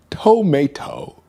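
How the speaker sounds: high, slow British "tomato"; exaggerated British accent. Shown as a demonstration. Streamer checking with chat, hammed-up British accent. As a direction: stretch out "tomato" in mock British. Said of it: "Like that?".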